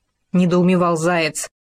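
ordinary delivery

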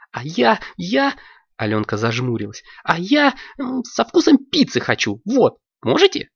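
high, squeaky and excited; a high child's voice begging to order fast; eager little girl. Acted. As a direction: bounce with impatience for ice cream. Алёнка orders, hesitating.

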